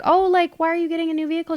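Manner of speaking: high, sweet customer-facing question; imitated friendly sales-office politeness; personable work voice. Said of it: "you almost have to sound personable".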